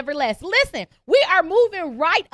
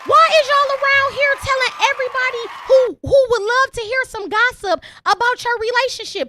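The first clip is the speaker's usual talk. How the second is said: exasperated rant; 8 semitones higher than usual. Real, not acted. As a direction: scold them in disbelief. Ranting at listeners.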